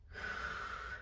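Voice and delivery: soft sighed-out breath; airy release of breath; exhale. Real, not acted. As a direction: breathe out into the stretch. Breathing out during stretch.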